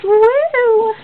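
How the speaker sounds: high whooping cheer; excited high whoop. Real, not acted. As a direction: whoop for joy. Celebrating six subscribers.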